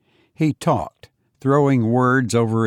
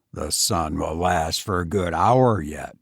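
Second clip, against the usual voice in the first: Fenwick's voice; 6 semitones lower than usual. Acted. Fenwick suggests a walk to Foster, low and subdued.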